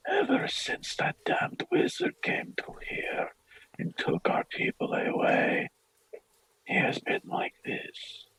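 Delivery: slow, soft whispered lament; whispering priest, bitter. Acted. Father explains his son's state to a visitor, slow whisper. Game master plays the grieving father.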